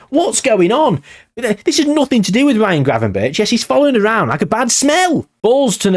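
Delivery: high-pitched comic exasperation; exasperated disbelief. Real, not acted. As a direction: rant as if this player is haunting you. Exasperated that the player keeps reappearing.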